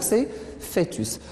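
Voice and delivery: usual speaking voice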